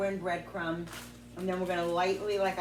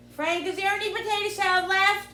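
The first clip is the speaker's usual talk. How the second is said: calling out; 8 dB louder than usual. Real, not acted. Calling to Frank in another room.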